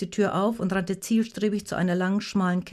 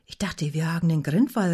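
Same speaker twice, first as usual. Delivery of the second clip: ordinary delivery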